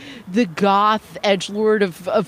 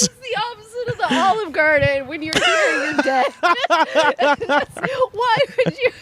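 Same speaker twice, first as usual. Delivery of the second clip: laughing joke; 10 semitones higher than usual. Real, not acted.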